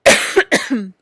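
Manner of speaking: a big high cackle that bursts out; loud burst of laughter. Real, not acted. Laughing hard at her own joke about Hollywood, loud laugh.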